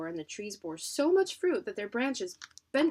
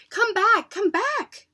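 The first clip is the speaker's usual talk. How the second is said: put-on high, loud call after the children; shouting; 13 semitones higher, 11 dB louder, much slower than usual. Judge shouting after fleeing children, loud. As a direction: yell for them to come back. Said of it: "the judge shouted".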